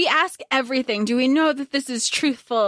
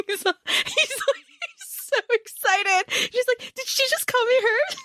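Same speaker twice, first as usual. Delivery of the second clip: much higher, slow, laughing squeal; squealing excitement; 11 semitones higher, slower than usual. Real, not acted. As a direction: giggle and squeal over it. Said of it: "fangirl squealing".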